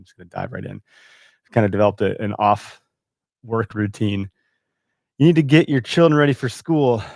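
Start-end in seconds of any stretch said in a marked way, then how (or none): 5.2-7.0 wife calling out. Retold or imitated.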